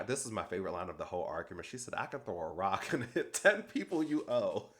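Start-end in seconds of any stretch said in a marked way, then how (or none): 2.0-4.7 amused retelling. Real, not acted. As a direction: laugh breaking into the quote. Laughs retelling her favorite line.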